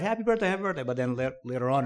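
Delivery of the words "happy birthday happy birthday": higher, bright mock greeting; playful singsong imitation; cheerful birthday wishes. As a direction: imitate a child being fussed over. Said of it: "it's like".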